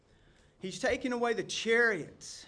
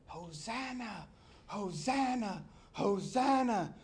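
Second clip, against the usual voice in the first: mock crowd shout; 3 semitones higher, slower than usual. The throng hailing Jesus, restrained mock shout. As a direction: imitate a distant throng, building gradually. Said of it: "The throng that was shouting".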